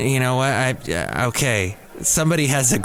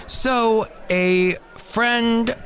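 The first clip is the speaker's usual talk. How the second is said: usual speaking voice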